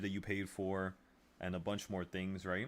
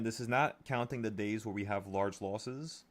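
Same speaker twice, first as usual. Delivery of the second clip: normal talking voice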